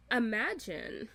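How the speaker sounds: normal talking voice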